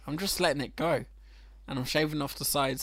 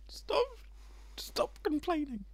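mock whiny; 14 semitones higher, 3 dB quieter, slower than usual. Teasing co-host mid-banter.

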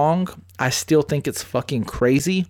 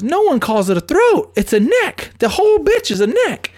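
put-on higher, louder insistent girlfriend; mock girlfriend arguing; 12 semitones higher, 4 dB louder than usual. Host mimics girlfriend insisting it's called a neck, heated and higher. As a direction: mimic her digging in, shrill and worked up.

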